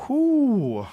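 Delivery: high falsetto whoo. Real, not acted. Reacting to deep tunnel.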